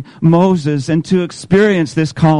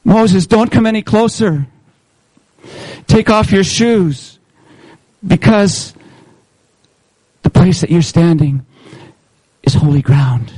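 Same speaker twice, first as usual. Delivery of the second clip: commanding voice of God; 3 semitones higher than usual. Acted. God warns Moses from the burning bush, solemn and commanding. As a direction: stop him before he comes closer. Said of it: "you hear this voice".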